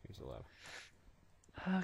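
slow secretive whisper. Acted. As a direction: breathe it out slowly. Sneaky reveal whispered.

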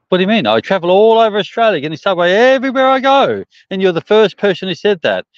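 loudly complaining to the counter staff; indignant outburst. Retold or imitated. Retold argument with Subway staff.

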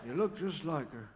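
put-on understated old man nostalgia; mock wistful. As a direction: pretend to miss her, half-joking. Puppet turns wistful about dead wife.

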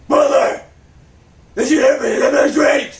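angry shout. Acted. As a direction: bark orders at the servant. Ignored, demands drink from butler.

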